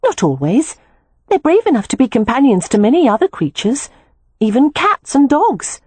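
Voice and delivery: a hint of a girl insisting gently; lightly voiced Beatrix, firm. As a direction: Beatrix defending rabbits to her sister, lightly voiced and firm.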